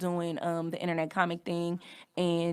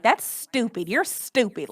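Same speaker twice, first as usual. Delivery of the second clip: harsh put-down; 4 semitones higher, slower than usual. Shown as a demonstration. Showing how relatives belittled the speaker, harsh. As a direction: hit STUPID as a serious put-down. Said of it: "like that".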